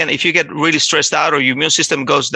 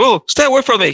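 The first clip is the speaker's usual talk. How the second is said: higher-pitched mock defensive "back off"; sudden raised-pitch warding off; retold defensive outburst; 8 semitones higher than usual. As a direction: imitate someone getting defensive. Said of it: "he got really defensive".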